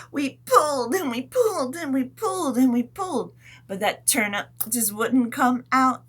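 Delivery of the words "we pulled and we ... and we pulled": higher, louder heave-ho chant; straining pull. Pretend. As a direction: strain together on each pulled.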